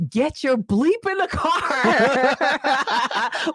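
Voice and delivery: high, slow, urgent laughing yell; frantic yell. Retold or imitated. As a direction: hurry everyone into the car. Retelling frantic sister yelling.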